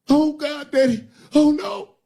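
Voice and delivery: very high, loud panicked cry; panicked wail. Acted. Bottom bitch finding the dead man, very high panicked wail. Woman panics over body.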